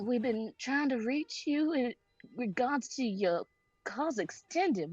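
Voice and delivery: ordinary delivery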